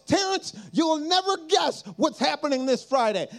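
high-pitched mock phone yell; retold excited yell. Friend phoning with huge news, mock yell. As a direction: burst out with big news, imitating an excited friend. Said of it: "because you're yelling at me".

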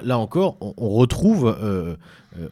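ordinary talk

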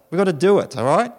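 usual speaking voice

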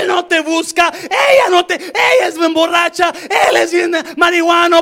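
high, full-throated shouted complaint; acted outraged plea, preached at full force; impassioned preaching cry. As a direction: cry it out to heaven, stretching the last words. Shouts a complaint to God while preaching.

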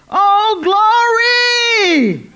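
a deacon bursting out from the back pew; startling shout of praise; retold shout. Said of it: "made you shout".

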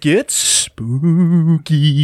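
slow, drawn-out ghost-story tease; hammed-up eerie announcement; mock-spooky voice. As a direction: stretch out "spooky" like a hammy ghost.

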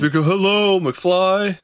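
mock Biff knocking on a head; playful movie bully jab; Biff Tannen quote. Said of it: "Biff Tannen over there".

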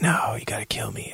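feeble, breath-only plea; mock deathbed whisper, very soft; dying whisper. Acts dying doctor begging.

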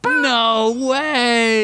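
high amazed no way. Real, not acted. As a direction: squeal in disbelief. Astonished at fun fact.

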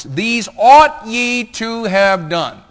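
very slow, high, loud proclamation; thundering scripture reading. Acted. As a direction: belt out the verse, stretching every word. Preacher reads scripture at full force.

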